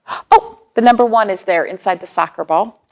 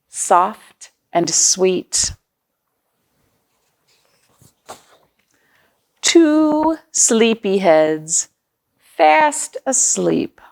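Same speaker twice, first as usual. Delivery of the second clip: slow, hushed lullaby reading; bedtime story reading; much slower than usual. Acted. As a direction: ease the lines out gently toward sleep. Reading bedtime lines aloud.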